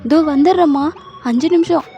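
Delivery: mocking husband. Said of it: "உடனே".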